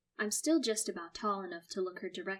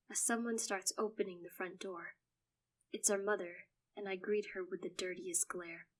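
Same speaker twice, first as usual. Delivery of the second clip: ordinary delivery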